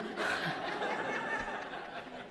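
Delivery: breathy laugh. Real, not acted. Laughs at own confession.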